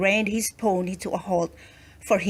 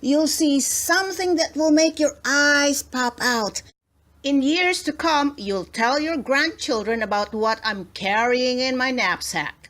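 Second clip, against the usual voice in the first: showman hype; 4 semitones higher than usual. A narrator's reading. Farmer hypes crowd.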